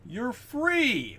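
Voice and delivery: high, loud, grand declaration; a hammed-up Lincoln freeing the slaves; mock Lincoln proclamation. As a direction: proclaim it like a triumphant Abe Lincoln. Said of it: "just says".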